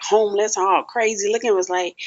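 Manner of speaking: ordinary talk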